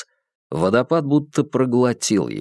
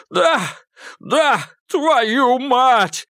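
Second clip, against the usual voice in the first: high, frantic pleading voice; desperate frozen man; 13 semitones higher, much slower than usual. Acted. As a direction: beg for life, freezing in the river. Malcolm begs from icy water. (отчаянная мольба замерзающего)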